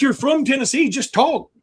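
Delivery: mock plea to Tommy Lee Jones; exasperated plea. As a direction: beg him to drop the act.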